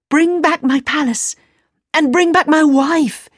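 eager high plea; crying out eagerly. Acted. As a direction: call out urgently. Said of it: "he cried".

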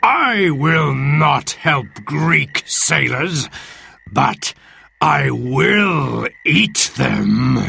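slow, menacing giant; Cyclops's yell. Acted. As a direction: threaten like a hungry monster, drawing words out. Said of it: "yelled the Cyclops".

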